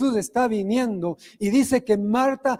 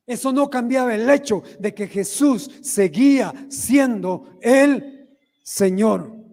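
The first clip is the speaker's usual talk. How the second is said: preaching climax; 3 semitones higher, slower than usual. Acted. Climactic preaching over music.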